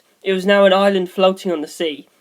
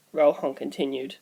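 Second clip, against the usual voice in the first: usual speaking voice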